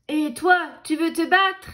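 loud challenge. A narrator's reading. Grumpy ladybug challenges lobster.

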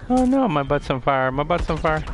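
panicked yelling. Real, not acted. Burning in game.

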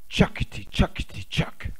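breathy chugging machine sound; whispered machine noise. A narrator's reading. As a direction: chug it out like a toy machine. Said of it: "goes the rubber duck machine".

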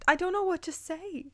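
normal talking voice

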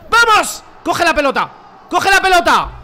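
loud urgent yell at his team; shouting at the players. Real, not acted. Gamer yelling at his players to grab the ball. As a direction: bark it at the screen, desperate.

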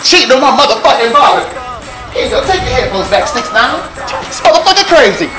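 usual speaking voice

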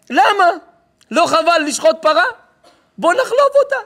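loud, high-pitched boastful crook; scheming kidnapper. Acted. Kidnapper boasting of his money-making plan, loud and excited. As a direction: crow the clever scheme at full volume. Kidnapper acted, plotting aloud.

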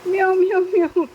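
high, loud meows; loud cat-call mimicry; cat meow imitation. Shown as a demonstration. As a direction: meow like a cat for a parrot to copy.

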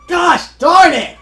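loud, slow, high yell of frustration; frustrated shout. Real, not acted. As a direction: yell it out at the game.

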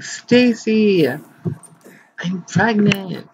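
high, very drawn-out dramatic news; milk the reveal like a soap star; mock announcement. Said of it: "We'll be like".

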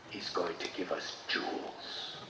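hushed, eager whisper; excited whisper. Acted. Townspeople whispering among themselves, excited. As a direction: breathe it to each other.

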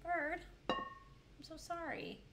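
very slow, high condolence; slow sympathetic. Real, not acted. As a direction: draw out the sorry gently.

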